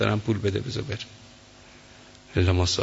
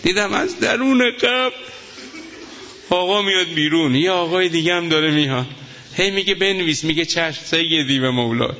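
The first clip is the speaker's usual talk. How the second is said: impassioned preaching; 10 semitones higher, 4 dB louder than usual. Acted. Preacher recounting the martyr's dream to mourners, impassioned wail. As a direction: wail the story out to the crowd. Preacher builds to emotional climax.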